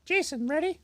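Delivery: silly put-on lady voice; goofy character voice. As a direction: play a goofy woman character. Holds put-on voice.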